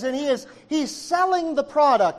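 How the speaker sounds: usual speaking voice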